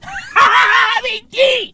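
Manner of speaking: shrieky high-pitched cackle; high squealed laugh. Real, not acted. Comedian reacting to the minister's title, high squealing laugh. Mock outburst over load shedding.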